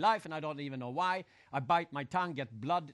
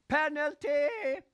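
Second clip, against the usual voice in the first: high, slow, loud shout of "Penalty!"; referee's call; 11 semitones higher, 8 dB louder, much slower than usual. Retold or imitated. Referee calling a penalty on the field, slow loud shout. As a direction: bellow "Penalty!" like a referee blowing the whistle. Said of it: "referee goes".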